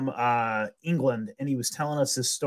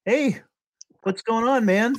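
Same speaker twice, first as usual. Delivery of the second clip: casual greeting pitched higher; friendly hey; 8 semitones higher than usual. Retold or imitated. Missionary greets the strange man who follows him, higher, casual. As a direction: call out to the stranger lightly.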